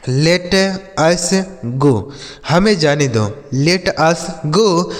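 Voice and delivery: drawn out word by word; slow dictation voice; very slow model phrase. Shown as a demonstration. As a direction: read it slowly for the class. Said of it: "teacher's slow model phrase".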